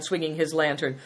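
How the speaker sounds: ordinary delivery, nothing marked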